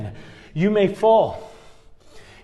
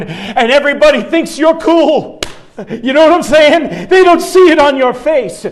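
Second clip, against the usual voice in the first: higher, louder, laughing through the sermon; preaching at full force; 5 semitones higher, 5 dB louder than usual. Acted. Pastor rousing the church about hidden fear, loud preaching. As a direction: whip the congregation up with a grin.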